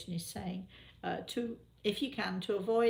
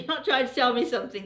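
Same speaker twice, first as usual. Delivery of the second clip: usual speaking voice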